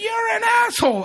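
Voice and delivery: very high-pitched imitation shriek; squealed mock outrage; mock outraged wife. Retold frightened wife yells from floor.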